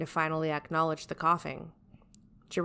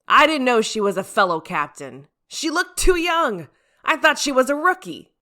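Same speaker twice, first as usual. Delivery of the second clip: insisting in defense; 8 semitones higher, 10 dB louder than usual. Acted. Captain defending his mistake to the team, loud high protest. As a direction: protest your innocence loudly. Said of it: "Jiraiya insisted in his defense".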